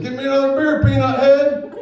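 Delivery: high, loud barking demand for a beer; drunk grandpa yelling. Retold or imitated. Grandpa yelling at the kid for another beer, loud and high. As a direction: holler it like a grumpy old drunk.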